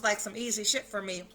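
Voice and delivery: ordinary delivery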